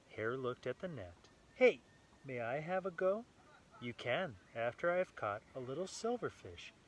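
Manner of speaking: lively read-aloud storytelling voice; story narration. A narrator's reading. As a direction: read it brightly to a small child. Reading picture book to child.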